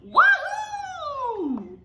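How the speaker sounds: high loud wahoo; excited whoop. Acted. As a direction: whoop with joy. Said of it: "what he thought was".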